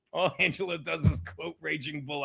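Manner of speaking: usual speaking voice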